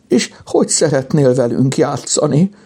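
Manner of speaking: high, whimpering question; tearful. A narrator's reading. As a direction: plead as if about to cry. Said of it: "Szólalt meg sírós hangon Kajtánka".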